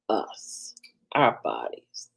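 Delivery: breathy hushed whisper, trailing away. Acted. Advising a live audience about grief, whispered. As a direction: let the words fade into a whisper. Soul crying out through us.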